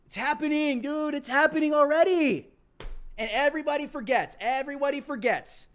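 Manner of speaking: excited. Real, not acted. Ranting about Biden as hero.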